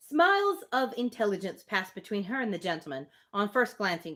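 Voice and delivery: steady, measured reading of the novel; story narration. Acted. Reading Emma aloud to a friend, measured narration. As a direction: read the Austen passage aloud, even and clear.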